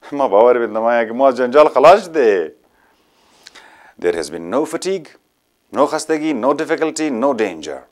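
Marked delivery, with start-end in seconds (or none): 0.1-2.5 laughing. Acted. Laughing at escaping troubles.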